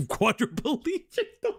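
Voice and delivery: Quadriplegic? high, slow and incredulous; Quadriplegic? rising in high laughing disbelief; amused disbelief. Real, not acted. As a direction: catch your own slip with a squeaky laugh.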